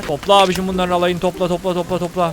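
low, soft repeated murmur; muttered self-prompting. Real, not acted. Gamer telling himself to gather all the loot, low muttering. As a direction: murmur it to yourself, low and repetitive.

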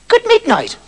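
high squeaky little elf voice; christmas elf. Acted. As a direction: chirp it like a tiny gnome. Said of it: "it said".

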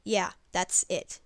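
ordinary delivery

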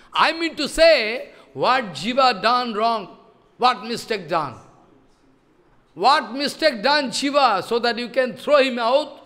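a hint of a stern guru scolding; mock indignant demand. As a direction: press him hard for the reason. Sanatan confronts Rupa over Jiva.

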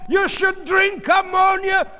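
slow, high, loud curse; angry yell. A narrator's reading. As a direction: scream it at him word by word. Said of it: "she yelled".

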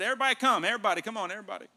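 loud, high mock summons; big acted shout of welcome; calling out. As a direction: call the whole crowd over. Acts out Jesus calling everyone in.

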